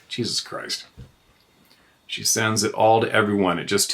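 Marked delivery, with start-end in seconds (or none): 0.1-0.8 whispered exasperation. Real, not acted. Exasperated at accusers.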